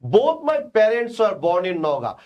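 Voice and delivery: very slow, even and deliberate; slow dictation. Shown as a demonstration. Teacher reading the model sentence slowly. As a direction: read the sentence out slowly for students. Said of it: "আমি বললাম".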